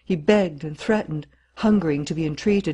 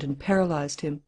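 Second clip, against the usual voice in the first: ordinary delivery, nothing marked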